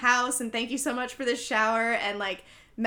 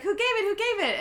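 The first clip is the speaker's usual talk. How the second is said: high, eager mock curiosity; eager asking; 10 semitones higher than usual. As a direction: ask it bright and much higher, like a thrilled hostess. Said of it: "Ashley's like".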